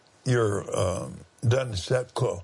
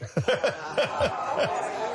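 high joyful laugh; high delighted laugh; 12 semitones higher than usual. Real, not acted. Laughter about church being born.

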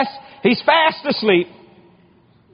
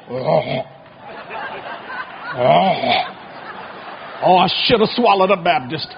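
groaning sick fish; 6 semitones lower, 3 dB quieter than usual. Retold or imitated. A sick fish groaning over the preacher it swallowed. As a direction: moan it like a fish about to throw up.

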